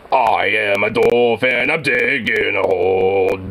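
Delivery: slow, put-on dwarf voice; mock dwarf announcing himself. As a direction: play a stout fantasy dwarf, slowly.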